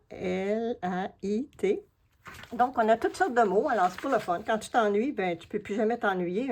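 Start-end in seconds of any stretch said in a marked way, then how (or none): 0.1-1.8 slow, letter by letter; spelling out letters. Shown as a demonstration. Crafter spelling a hidden word for viewers, slowly. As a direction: spell "L A I T" out one letter at a time. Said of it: "Les, hein".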